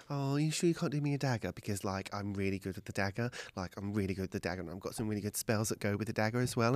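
quick, gushing plea; rambling plea. Acted. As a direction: babble on to get the dagger. Cass rambles pleading for a dagger.

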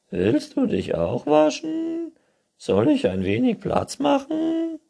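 high, loud chirpy raccoon voice; squeaky cheerful raccoon; friendly raccoon. A narrator's reading. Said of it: "Der sprach es freundlich an". (freundlicher, hoher Waschbär)

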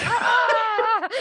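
high, loud mock yell through laughter; laughing scream imitating an angry outburst; imitated yell. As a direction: yell it while cracking up. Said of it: "Peacemaker just yelled at him".

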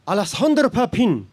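loud, high, over-the-top French pronunciation of "Alessandro Papin"; hammed-up French accent at the customs desk; thick French accent. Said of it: "a very thick French accent".

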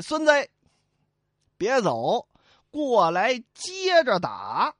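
slow, drawn-out jeer; taunting. Acted. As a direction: dare him to come fight. Fish owner taunts Li Kui. (慢悠悠地挑衅)

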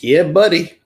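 Alabama "Yeah, buddy." drawn out with a hearty lift; hearty Southern "Yeah, buddy."; Southern Alabama drawl. Shown as a demonstration. Host demos an Alabama phrase, hearty Southern accent. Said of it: "it was".

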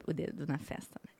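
unvoiced whisper aside. Real, not acted. (sussurrando à parte)

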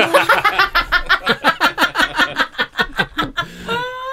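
long, high-pitched burst of laughter; real, helpless high laughing fit; big belly laugh. Real, not acted. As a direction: crack up at your own joke. Laughing at own dirty joke.

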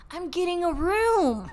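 insistent. Retold or imitated. Confused friend at front desk insisting to roommate, slow and stubborn. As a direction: imitate a stubborn friend, stretching the words.

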